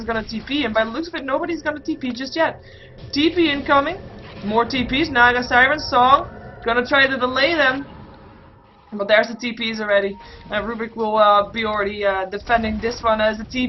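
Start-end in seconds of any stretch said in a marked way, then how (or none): none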